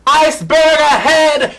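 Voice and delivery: high, slow ship's lookout call; mock alarm cry. Vlogger calls out a warning, high and drawn out. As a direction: cry it out like a lookout spotting ice.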